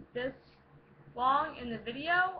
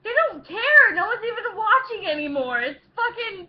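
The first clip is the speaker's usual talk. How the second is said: exasperated shout; 8 semitones higher, 10 dB louder than usual. Real, not acted. Protesting nobody watching anymore.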